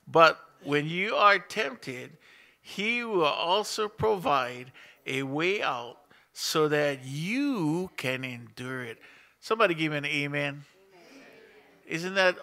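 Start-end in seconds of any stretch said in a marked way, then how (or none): 0.1-9.0 slow, loud, deliberate Bible reading; scripture reading. Acted. Pastor reading verse to church, slow and loud. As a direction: read each phrase slowly to the congregation.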